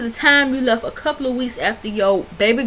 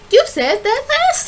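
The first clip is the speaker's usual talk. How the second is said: exasperated scolding; 4 dB louder than usual. Real, not acted. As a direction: throw her words back at her. Scolding the wife.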